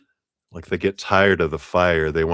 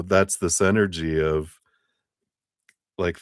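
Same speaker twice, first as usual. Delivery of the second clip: usual speaking voice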